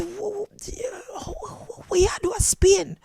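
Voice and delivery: high, soft stammer of disbelief; mock bewilderment. Jamaican surprised by countryman in Spain, high stammer. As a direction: stutter in high-pitched astonishment. Startled reply to the man.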